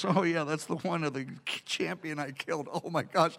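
low, very soft admission; sheepish aside. Acted. David admitting to the king whose sword he carries, sheepish and hushed. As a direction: say it under your breath, dawning regret.

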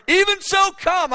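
high, loud cry; John crying out in prayer; fervent prayer cry. Retold or imitated. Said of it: "he begins to cry out".

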